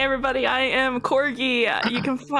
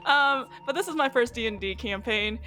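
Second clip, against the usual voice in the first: ordinary talk, nothing marked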